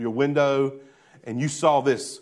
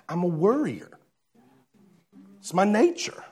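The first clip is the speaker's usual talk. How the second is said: sheepish excuse; 3 semitones higher than usual. Acted. Acted worrier excusing himself to Jesus.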